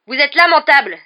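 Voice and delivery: high, loud outburst; indignant cry. A narrator's reading. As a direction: hurl the accusation in outrage. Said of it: "s'écria alors Harry". (cri indigné)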